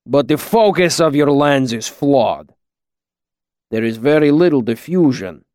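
put-on deliberate accented master; Kilvin, slow. A narrator's reading. Master criticising student's lens, slow accented verdict. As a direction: point out the fault slowly and firmly. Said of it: "Kilvin said slowly".

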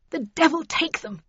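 high, vexed muttered oath; muttered curse. A narrator's reading. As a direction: curse crossly to yourself. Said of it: "she muttered".

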